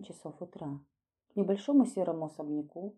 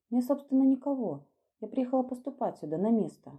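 higher, louder voice for an embarrassed newcomer; embarrassed young woman; 7 semitones higher, 6 dB louder than usual. A narrator's reading. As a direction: explain yourself in embarrassment, pitched up. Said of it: "произнесла смущённо". (смущённым девичьим голосом)

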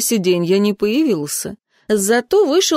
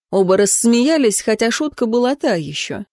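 usual speaking voice

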